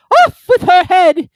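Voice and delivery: high, loud, slow royal bellow; furious shout. A narrator's reading. Queen sentencing players at croquet, furious slow shout. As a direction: scream the sentence, drawn out.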